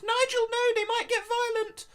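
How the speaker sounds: very high, loud squeal; hammed-up squeaky alarm; panicking penguin wife. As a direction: shriek a warning at your husband. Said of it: "turns to its husband and goes".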